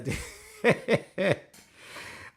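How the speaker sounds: airy burst of laughter; real laugh, barely voiced; breathy laugh. Real, not acted. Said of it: "can't even read it with a straight face".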